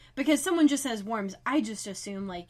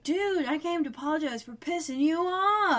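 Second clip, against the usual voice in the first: higher, breezy casual-dude tone; playful dude voice; 7 semitones higher, 3 dB louder than usual. Retold or imitated. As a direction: toss it off like a cocky charmer. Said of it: "he's doing a real fun tone with this".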